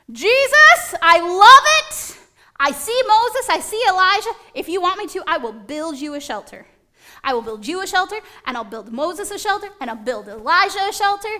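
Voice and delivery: thrilled gushing. Acted. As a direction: burst out with giddy delight. Peter thrilled on mountaintop.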